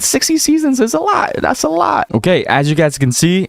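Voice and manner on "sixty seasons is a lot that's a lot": amazed high squeak. Real, not acted. To viewers, stunned at sixty seasons, squeaky high amazement. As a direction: pitch jumps way up in disbelief.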